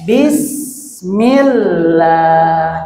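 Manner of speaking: Bismillah stretched slow with a long s; hissing demo. Shown as a demonstration. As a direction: model Bismillah slowly with a hissed s. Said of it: "Coba baca-baca".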